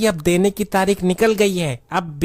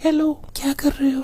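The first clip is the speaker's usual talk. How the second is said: put-on high girlish phone voice; coy young woman voice; man doing a woman's voice; 7 semitones higher than usual. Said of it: "आप किस आवाज में लोगों को बेवकूफ बनाते थे".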